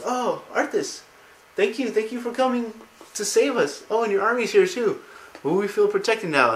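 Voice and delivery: high mock voice of relieved townsfolk; grateful villagers. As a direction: gush thanks to your saviour, high-pitched. Sick villagers greet their prince.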